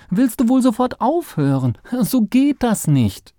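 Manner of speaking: high, loud scolding; protesting. A narrator's reading. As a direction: make him stop at once. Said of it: "protestierte Sandkörnchen".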